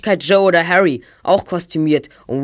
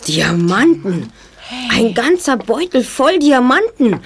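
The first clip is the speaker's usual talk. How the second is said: awed; 5 semitones higher, slower than usual. Acted. Boy discovers a bag of diamonds.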